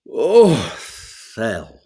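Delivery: mock weary old man's "Oh, Thel"; sighing as you sit down like granddad; granddad's sigh. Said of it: "he went".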